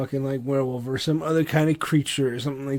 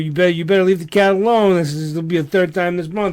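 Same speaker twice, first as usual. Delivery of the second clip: louder, higher pet-owner reprimand; mock scolding; 4 semitones higher, 6 dB louder than usual. Owner warns werewolf girlfriend off the cat, mock exasperation. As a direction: playfully tell off a misbehaving animal.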